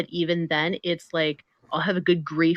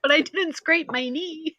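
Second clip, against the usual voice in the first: put-on light child voice; 10 semitones higher than usual. Voicing the young son.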